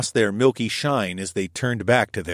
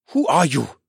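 gasped; 6 semitones higher, 4 dB louder than usual. A narrator's reading. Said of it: "he gasped".

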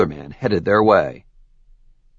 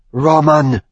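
a sharp spat name, a touch louder; 6 semitones higher, 5 dB louder than usual. Acted. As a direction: spit the name out in disgust. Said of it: "Samia spat".

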